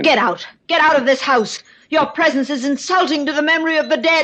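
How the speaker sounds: high, sharp furious order to leave. Acted. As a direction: throw him out of the house in fury. Ordering him out in anger.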